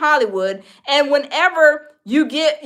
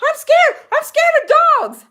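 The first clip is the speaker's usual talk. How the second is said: high, louder frightened wail of a grown woman; mock scared squeal; 13 semitones higher, 5 dB louder than usual. Friend on the couch afraid of the little dog, high mock frightened squeal. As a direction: squeak it way up, playing terrified.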